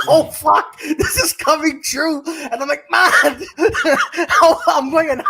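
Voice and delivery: hammed-up fear, laughing; mock panic. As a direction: act out being scared of the cops, laughing. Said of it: "I was scared shitless".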